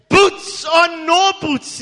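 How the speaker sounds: loud, high, slow triumphant yell; shouted at the top of his voice. Retold or imitated. Poor man leaping up in church, triumphant yell. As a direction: belt it out with joy. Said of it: "at the top of his voice".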